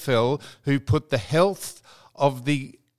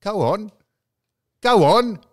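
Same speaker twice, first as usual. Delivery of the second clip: louder, higher goading; teasing dare; 5 semitones higher, 5 dB louder than usual. Real, not acted. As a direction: egg him on. Daring co-host to remove mask.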